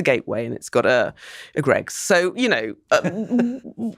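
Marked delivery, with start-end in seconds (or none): none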